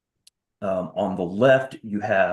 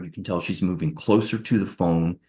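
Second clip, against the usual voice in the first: ordinary talk, nothing marked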